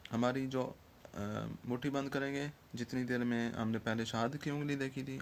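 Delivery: normal talking voice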